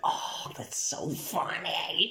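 high, slow whisper; whispered girlfriend voice. Retold or imitated. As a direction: breathe it in a light girly whisper. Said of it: "she would be like".

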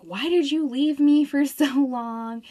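high, loud mock whine from a kid; hammed-up upset kid, half laughing; upset son. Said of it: "My son gets really upset at me".

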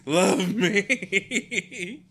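high, loud, laughing dog voice; voicing the dog. Owner voices her dog begging, high and laughing. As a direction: play the needy dog, laughing. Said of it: "She's like".

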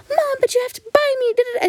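high, tearful mock whine; whining kid. Kid demanding that mom buy it, mock whining. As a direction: whine way up high like a spoiled child. Other kids whine at their moms.

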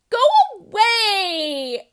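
high, loud, slow crying shout; tearful order. Acted. Ellie ordering the elephant away, crying shout. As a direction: yell it through tears. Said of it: "Ellie ordered".

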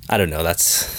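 ordinary talk, nothing marked

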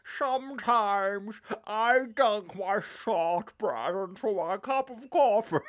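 slow, drawn-out mock Nicolas Cage; a slowed, hammed-up Nicolas Cage; bad Nicolas Cage impression. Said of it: "do an impression of".

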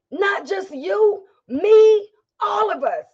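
high cry rising to a shout; impassioned cry. Real, not acted. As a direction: cry out with everything. Peak of the appeal.

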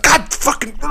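high, strangled cursing through a squeezed throat; muttered cursing. Retold or imitated. Son cursing to himself while getting the wings, high strangled muttering. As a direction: squeeze out the curse as a high, choked growl. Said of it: "start mum-, under his breath".

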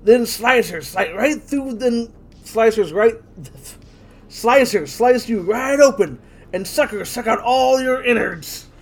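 slow, gloating backwoods villain; creepy hillbilly villain. Acted. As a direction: savor each gruesome step slowly. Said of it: "Go for his lines".

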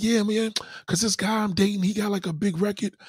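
put-on high bragging girlfriend voice; high starry-eyed girlfriend boasting; mock girlfriend. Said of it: "And she be like".

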